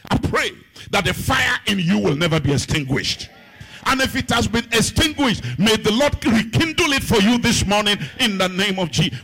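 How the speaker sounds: loud chanted pulpit prayer; fervent sung-out sermon prayer; preaching prayer. Acted. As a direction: call it down on the enemy like a preacher. Chanted prayer at full force.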